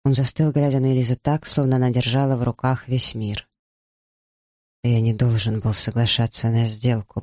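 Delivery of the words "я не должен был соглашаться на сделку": usual speaking voice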